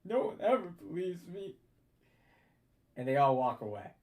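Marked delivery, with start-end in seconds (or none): 0.0-1.6 sulky Jerry. Acted. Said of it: "one voice goes like".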